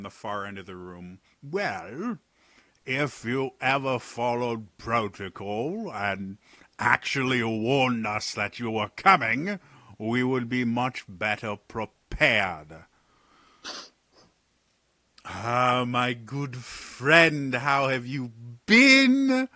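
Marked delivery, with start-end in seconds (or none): 1.4-12.8 slow, clipped-back scolding from afar; lightly voiced annoyed official; irritated guard. Guard scolds Talon for skipping protocol, irritated. 15.3-19.5 high, loud, theatrical exasperated sigh; a wry noble teasing the jumpy guards; Talon's voice. Acted. Talon scolds the guards for their glaives, mock weary. Talon greets old friend.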